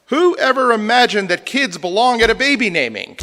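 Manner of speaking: loud, high-pitched sarcastic disbelief; mock outrage. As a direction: exclaim it as if scandalized by the obvious. Mock outburst at crying baby.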